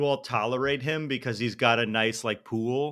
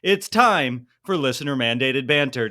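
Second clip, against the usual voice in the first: energetic, higher-pitched host intro; podcast intro read; 4 semitones higher than usual. Acted. Host teasing the show before banter, lively intro read. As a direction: punch up the show rundown.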